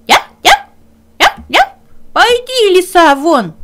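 high, loud yapping shout; barking dog. Acted. Dog barks the fox out of the hut, loud and high. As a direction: bark and order the fox out.

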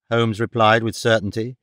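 ordinary delivery, nothing marked

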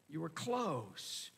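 soft, very slow and low; hushed regret. Acted. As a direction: drop to a quiet, regretful murmur. Voicing Jesus's sorrowful verdict.

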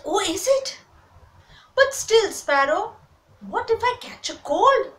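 high-pitched, anxious questioning; worried little duck. A narrator's reading. Duck asks sparrow about catching cold, high and worried. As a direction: fret aloud as a small timid duck.

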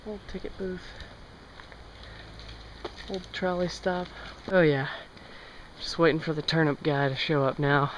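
usual speaking voice

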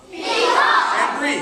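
loud, high group chant; class shouts it. Real, not acted. Students repeating after the teacher, loud chant. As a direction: shout it back together.

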